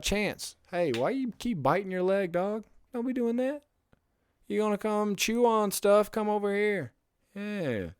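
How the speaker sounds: high, soft scolding voice for the dog; talking to the dog. Real, not acted. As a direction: chide the dog gently in a high pet voice. Owner scolds his chewing dog.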